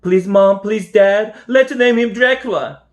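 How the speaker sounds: pleading high and loud; crying out. A narrator's reading. Toby begs Mom and Dad to name the bunny, high pleading cry. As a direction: beg your parents like a desperate kid. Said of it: "cried Toby".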